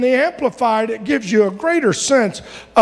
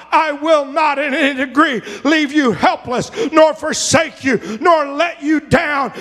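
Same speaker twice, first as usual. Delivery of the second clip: preaching at full force; 6 semitones higher than usual. Acted. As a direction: hammer the promise home. Preaching the Amplified promise.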